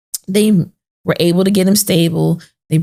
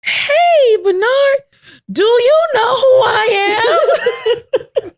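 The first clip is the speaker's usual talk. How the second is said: mom's excited greeting; slower than usual. Retold or imitated. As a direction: call out to him like a thrilled mom. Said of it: "My mom goes".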